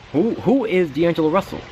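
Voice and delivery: high, incredulous who-is-this question; mock bafflement, voice jumping up; baffled disbelief. Recalling draft day, asking who this pick is, baffled high. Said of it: "And I was like".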